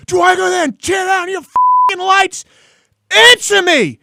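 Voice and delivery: high, drawn-out furious yell. Retold or imitated. Actor in his tirade at the guy, screaming. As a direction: imitate a screaming on-set tirade. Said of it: "he's yelling at the guy".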